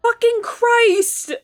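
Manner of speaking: very high, stretched-out scream of a curse; terrified shout. Real, not acted. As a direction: shriek it in real fright. Jump scare in film.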